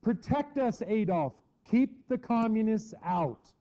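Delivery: high, pleading mock plea; frightened crowd pleading. German people begging Hitler for protection, high mock plea. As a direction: beg like a scared crowd. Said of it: "And they said".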